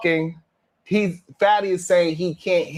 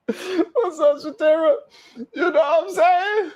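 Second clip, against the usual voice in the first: laughing call-out; 9 semitones higher than usual. Real, not acted. Laughing, calls out to chat.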